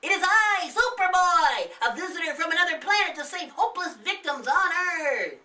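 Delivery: high, loud, grand hero voice; a boy hero announcing himself; proclaiming superhero. Acted.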